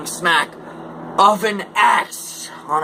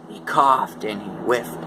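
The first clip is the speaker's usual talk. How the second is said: usual speaking voice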